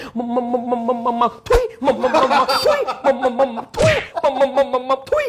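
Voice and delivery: high, slow stuck stutter on มัม; stuttering friend. Retold or imitated. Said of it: "มีเพื่อนติดอ่าง".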